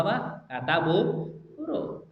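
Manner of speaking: ordinary talk, nothing marked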